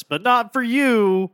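high, loud jab at the listeners; mock taunt. Teasing listeners during show intro.

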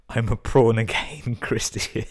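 slow, high and loud with real laughter; words broken up by laughing; laughing through punchline. Real, not acted. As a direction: crack up while repeating the joke. Cracking up at repeated punchline.

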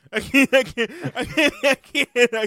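breaking up laughing, high and loud; can't get words out for laughing. Real, not acted. Laughing fit, can't speak.

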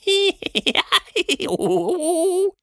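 very high, silly creature giggle; squeaky rising giggle from nowhere. Acted. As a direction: giggle like a tiny hidden creature. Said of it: "albern giggelnden Klang".

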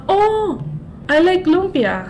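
high, bright mock-excited foreigner voice. Mocking a foreigner who only knows lumpia, high and excited. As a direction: parody a clueless foreigner gushing. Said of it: "Sabi na".

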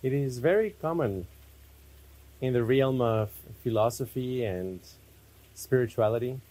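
spacious, unhurried meditation guidance; guided meditation. Real, not acted. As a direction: lead them inward unhurriedly, phrase by phrase.

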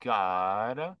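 put-on slow, lazy LA accent, Kardashian-style. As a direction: drag the words out in a Valley girl LA accent. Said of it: "they have that accent".

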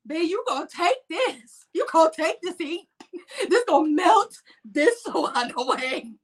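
high, giggling self-encouragement; pep talk breaking into laughter; self pep talk with laughs. Retold or imitated. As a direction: laugh while psyching yourself up in the sauna. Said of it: "I'm sitting there like".

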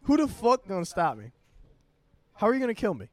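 loud, high mock defiance; taunting challenge. Blocking coworker's way, loud mock taunting. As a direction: goad them like nobody can touch you. Acted taunt while squaring up.

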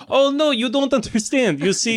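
put-on high-pitched foreign-accented villain; villain lecturing with a put-on accent; accented anti-pope. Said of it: "You're like".

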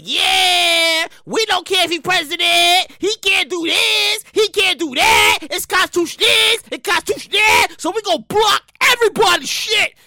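hammed-up obstinate ruling; indignant judge. Obscure judge blocks the loan relief, mock-indignant shout.

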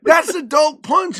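high, loud and drawn-out scolding; mock outraged mom. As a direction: imitate a mother's slow, emphatic telling-off. Mom scolds child in retold row.

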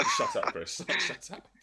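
high laughing protest; real laughing shut up. Real, not acted. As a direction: squeal it through laughter. Laughing at the clip.

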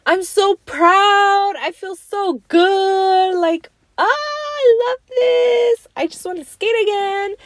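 high, slow, gushing joy; bursting with pride; thrilled gushing. Retold or imitated. In the car after skating again, gushing high and thrilled.

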